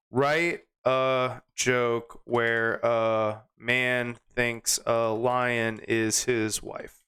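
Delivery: very slow, word by word; typing aloud. Real, not acted. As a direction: dictate it to yourself as you type. Types the prompt while saying it.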